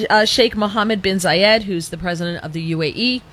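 ordinary talk, nothing marked

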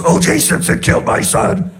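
strained, high mock yell; retold yell. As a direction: yell it as a worn-out stock answer. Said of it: "he just yells".

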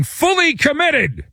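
high-pitched, slow, insistent proclamation; imitating an owner shouting, drawn out; mock shouting. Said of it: "starts shouting".

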